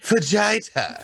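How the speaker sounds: "Fajita" drawn out as fuh-ZHY-tah; deliberate mispronunciation. Pretend. Joking after talk of saying it correctly, "Fajita" wrongly as fuh-ZHY-tah. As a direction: say "Fajita" high and slow, rhyming with "lighter".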